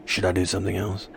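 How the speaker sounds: low, quiet put-on voice; quick, low character aside; put-on creepy voice. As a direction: offer it softly in the creepy voice. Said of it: "You don't like this voice?".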